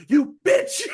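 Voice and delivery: high, loud burst of mock fury; mock outrage. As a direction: explode in hammed-up indignation. Acted furious reply in retold scene.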